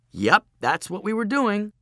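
high, put-on brightly innocent voice; eager agreement. Boy backing the girl's invented story, high eager agreement. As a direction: go along with the cover story eagerly.

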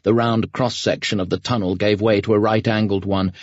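plain reading voice

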